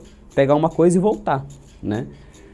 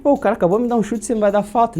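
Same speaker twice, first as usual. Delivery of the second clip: indignant protest; 7 semitones higher, faster than usual. Retold or imitated. Protesting to the referee.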